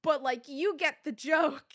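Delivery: loud, slow, high-pitched backpedal; hasty cover-up. Retold or imitated. Caster covers up a trash joke, exaggerated backpedaling. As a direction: drag out an over-earnest, awkward retraction. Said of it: "I tried to cover up so hard".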